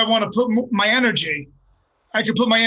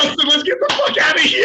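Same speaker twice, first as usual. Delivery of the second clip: higher, raised-voice panic retold; urgent mock yell; 6 semitones higher, 3 dB louder than usual. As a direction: call your buddy to flee, hammed-up. Retelling his urgent call to leave.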